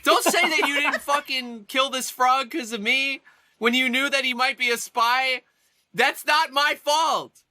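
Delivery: high, heated self-defence; upset protest. Acted. Player refusing blame for the frog, upset protest. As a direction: push back hard, pitch climbing toward the end.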